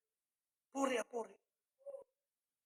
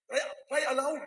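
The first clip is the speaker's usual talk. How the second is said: preacher's raised, higher-pitched cry; loud voice; 4 semitones higher, 3 dB louder than usual. Shown as a demonstration. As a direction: cry it out to the congregation. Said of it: "with a loud voice". (malakas na pasigaw na pangangaral)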